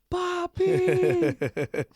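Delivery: put-on very high, drawn-out child's shout; kid yelling Papi. A kid spotting him across the party, shouting high. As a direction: yell it across the hall like a little kid. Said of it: "He goes".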